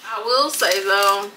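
speaking between bites; talking while chewing. Real, not acted. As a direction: mouth partly full. Seen 2: Bites at -1.0; lips pressed chewing until +2.0, then sandwich only held.

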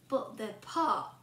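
plain reading voice

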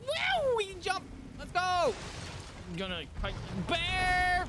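high, loud whoop, dragged out slow; excited shout. Real, not acted. Player charges the bear on horseback, whooping. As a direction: yell it as you charge in.

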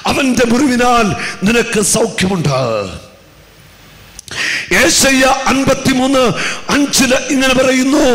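high, loud pulpit proclamation; full-force preaching. Acted. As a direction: preach it hard over the music. Preacher proclaims scripture loudly. (ഉച്ചത്തിലുള്ള പ്രസംഗം)

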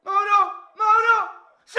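high loud ecstatic cry; ecstatic shout. Real, not acted. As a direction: scream the name in joy. Fan celebrating a goal.

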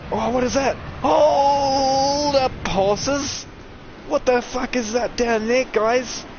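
high loud surprised yell; startled shout. Real, not acted. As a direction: shout in alarm.

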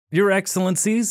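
"Your Excellencies" in a lightly hammed-up, overly respectful tone; bow to them in words, tongue in cheek; mock reverence. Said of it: "with mock reverence".

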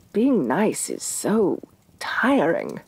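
slow, whispered, drained; tired whisper. Acted. Queen worn out from being nice, slow weary whisper. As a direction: sigh the words out as if exhausted.

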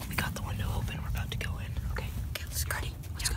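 soft sneaky whisper. Real, not acted. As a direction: whisper so nobody wakes. Climbing into the room.

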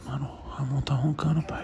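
hushed whisper near a sleeper; whispering while hiding. Real, not acted. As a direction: whisper so nobody wakes. Whispering so sleeper won't wake.

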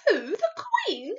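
high, loud, drawn-out disbelief; aghast cry. A narrator's reading. Sophie horrified for the Queen, aghast cry. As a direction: gasp the question in horror.

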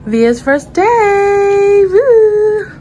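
loud, high, drawn-out cheer; excited cheer. Real, not acted. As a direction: cheer loudly. Cheering at church.

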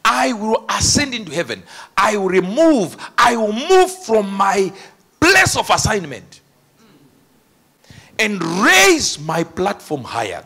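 emphatic, drawn-out boast; boastful proclamation. Acted. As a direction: proclaim it grandly, stretching the key words. Acting out the devil's boast.

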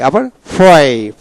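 usual speaking voice

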